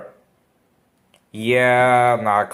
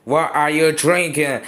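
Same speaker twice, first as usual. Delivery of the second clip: ordinary delivery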